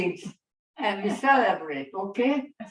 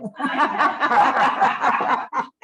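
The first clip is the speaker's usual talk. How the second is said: long, loud higher-pitched laugh; nervous laugh; 6 semitones higher, 5 dB louder than usual. Real, not acted. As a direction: laugh off the stage fright. Laughing off her stage fright.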